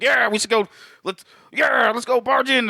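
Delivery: high, loud, eager push; eager excitement. Retold or imitated. Retelling eager neighbors.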